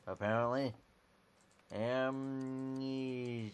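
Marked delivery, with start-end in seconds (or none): 1.7-3.5 slow, drawn-out amnesia; slow, spaced-out reading of Amnesia. Shown as a demonstration. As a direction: stretch the word out dreamily.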